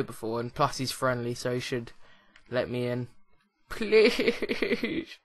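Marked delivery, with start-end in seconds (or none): 3.7-5.2 giggly begging please; laughing plea. Acted. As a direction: beg through a laugh. Begging neighbour to let in.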